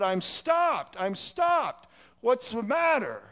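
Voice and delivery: exasperated retold cry. Driver shakes frantic man on bridge, exasperated retold cry. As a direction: imitate a driver calling out insistently.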